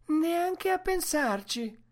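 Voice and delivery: high, drawn-out woman's voice in dainty dismay; dismayed Mercedes. A narrator's reading. Woman rejecting the idea of leaving the tent, high dainty dismay. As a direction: exclaim it high and slow, appalled. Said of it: "con delicata costernazione".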